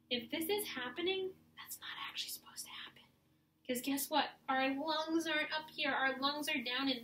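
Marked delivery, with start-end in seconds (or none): 1.5-3.0 whisper. Real, not acted. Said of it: "aside to class".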